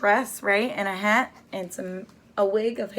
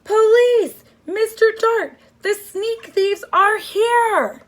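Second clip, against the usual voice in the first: much higher, very loud yelling for help; boy in a frame alerting the police, full-voice shout; loudest shout; 12 semitones higher, 9 dB louder, slower than usual. Acted. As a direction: scream it across the dark hall, slowing at the end. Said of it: "In his loudest voice, he shouted".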